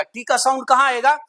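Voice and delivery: ordinary talk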